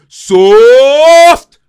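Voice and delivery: shouted. Shown as a demonstration. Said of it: "S A W F T".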